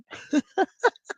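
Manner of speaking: short chuckle at a joke; dry laugh. Real, not acted. Amused at own joke about running with kids, laughing.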